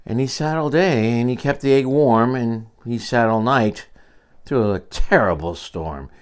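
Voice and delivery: plain reading voice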